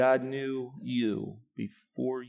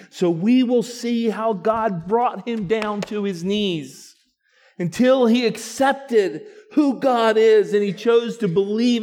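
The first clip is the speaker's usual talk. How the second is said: loud, high preaching, building; full-force preaching; 8 semitones higher, 10 dB louder than usual. Acted. Pastor rousing the congregation with full preaching. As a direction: preach it out to the church.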